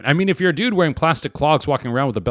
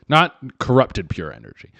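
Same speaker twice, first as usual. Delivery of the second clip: usual speaking voice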